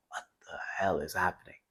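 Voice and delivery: quiet, bewildered aside to himself; puzzled inner thought. Retold or imitated. Wondering to himself at his partner's sudden dark mood, quiet and baffled. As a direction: say it low, as if only thinking it.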